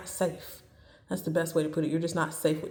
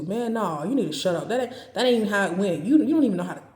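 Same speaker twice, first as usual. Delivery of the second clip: loud, higher-pitched heated comeback; raised-voice, dismissive arguing; mock argumentative man; 3 semitones higher, 7 dB louder, much faster than usual. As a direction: cut them off like a defensive partner, getting louder. Acting out a man who argues back.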